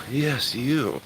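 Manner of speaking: high cooing pet voice. Real, not acted. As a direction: coo at the kitty. Talking to the cat.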